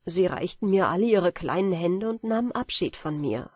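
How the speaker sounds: usual speaking voice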